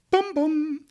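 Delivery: high sung doorbell "Bum, bum"; doorbell imitation. Shown as a demonstration. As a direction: chime it like a doorbell. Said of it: "think about".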